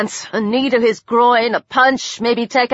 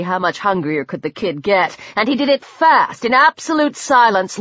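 ordinary delivery

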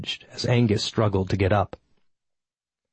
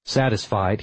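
ordinary delivery

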